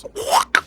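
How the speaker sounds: whispered squelch sound; voiceless imitated squish noise. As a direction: breathe out a wet squish. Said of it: "It goes".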